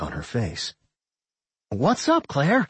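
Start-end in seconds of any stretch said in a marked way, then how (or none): 1.7-2.7 bright, upbeat greeting; cheery high-pitched hello; cheerful. A narrator's reading. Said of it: "Doc asked cheerfully".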